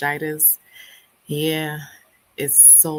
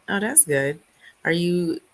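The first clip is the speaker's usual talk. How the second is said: normal talking voice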